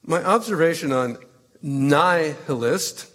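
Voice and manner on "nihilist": nihilist drawn out slowly as NIGH-hill-ist; slow, pointed nihilist with a long "nigh" opening; corrected pronunciation. Acted. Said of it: "was prompted by your having corrected my pronunciation".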